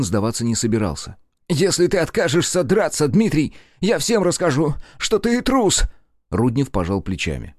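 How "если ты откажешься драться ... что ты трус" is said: furious threat. A narrator's reading. Nikitin threatening to shame Rudnev, furious. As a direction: threaten him to his face.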